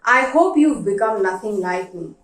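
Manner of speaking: ordinary delivery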